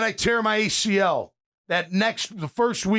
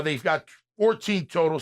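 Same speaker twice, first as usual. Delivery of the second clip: usual speaking voice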